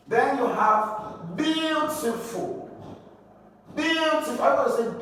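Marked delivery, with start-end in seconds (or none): 1.4-2.7 beautiful with BEAU held long and high; slow teaching stress on BEAU-tiful; stress on first syllable. Shown as a demonstration. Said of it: "first syllable here that will be stressed". 3.8-4.4 beautiful with BEAU pitched high; high BEAU, falling away on -tiful; stress on first syllable. Shown as a demonstration. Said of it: "first syllable here that will be stressed".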